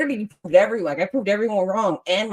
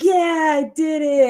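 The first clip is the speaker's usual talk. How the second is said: triumphant cheer; 8 semitones higher, 4 dB louder, slower than usual. Retold or imitated. Retold triumph.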